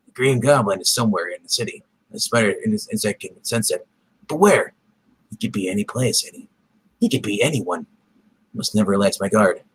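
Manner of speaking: low, tense superhero inner thoughts; wary hero thinking aloud; brooding hero voice. Acted.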